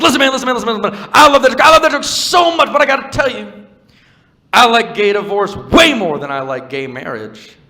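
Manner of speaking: high, loud auctioneer patter; hammed-up auctioneer standing in for a Southerner. Southern comedian praising the joke to the comic, as a loud auctioneer. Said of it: "so I do an auctioneer instead".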